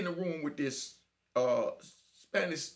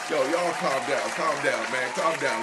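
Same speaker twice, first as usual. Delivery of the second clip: raised voice over the noise; loud appeal for calm; 8 dB louder, slower than usual. Real, not acted. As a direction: cut through the commotion to settle everyone. Breaks off to quiet the listeners.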